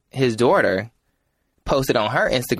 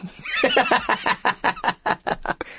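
high rising witch cackle; 4 dB quieter than usual. Acted. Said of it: "sound like a witch right now with that laugh".

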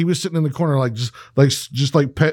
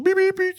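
high playful beep beep; jokey high-pitched beeps; mock horn beeps. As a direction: toot like a tricycle horn. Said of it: "shows up".

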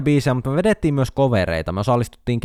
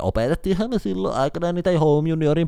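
proud teacher; 5 semitones higher than usual. Retold or imitated. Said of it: "musiikinopettajat mainitsi".

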